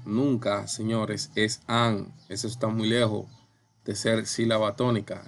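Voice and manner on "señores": normal talking voice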